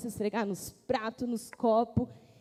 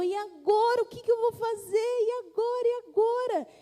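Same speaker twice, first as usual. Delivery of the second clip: higher, louder panicked cry of the dishes; desperate dishes; 8 semitones higher, 3 dB louder than usual. Acted. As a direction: wail it like a dish in a panic. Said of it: "aquela louça ficou desesperada". (louça desesperada, voz mais aguda e alta)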